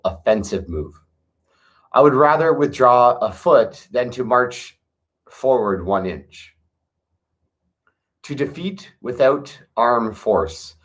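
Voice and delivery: steady meditative recitation; scripture reading. Acted. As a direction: read each line like a sacred verse, unhurried. Continues reading the chapters.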